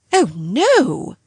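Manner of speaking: firm, decided no; with decision. Acted. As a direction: shut it down firmly. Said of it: "said Missus Grose with decision".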